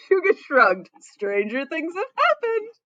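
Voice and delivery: high, slow, loud knowing delivery; amused, laughing read. Real, not acted. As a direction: let the laugh carry the line. Reader amused, knowing aside.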